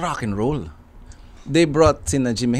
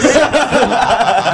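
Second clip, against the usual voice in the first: loud high burst of laughter; 9 semitones higher, 6 dB louder than usual. Real, not acted. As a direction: let a real laugh burst out at the answer. Laughing at the "yes" answer.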